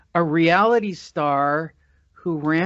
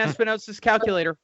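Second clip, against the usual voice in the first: normal talking voice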